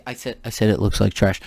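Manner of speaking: loud repeated protest; exasperated shout. Real, not acted. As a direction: shout it again so they hear. Repeating himself, fed up.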